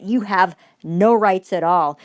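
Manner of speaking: slow, every word pressed; no rights, spelled out; slow emphatic. Acted. Woman lecturing public servants, slow and emphatic.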